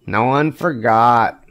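usual speaking voice

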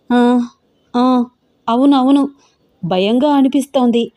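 halting, mildly flustered agreement; flustered stammering. A narrator's reading. Young woman covers up her scream, faltering and flustered. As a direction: stumble into a hasty cover story, pausing between words. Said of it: "తడబడుతూ కంగారు పడుతూ అంటోంది శ్రద్ధ".

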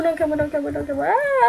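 ordinary talk

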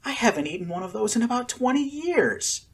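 delighted. A narrator's reading. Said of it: "Chihiro gasped in delight".

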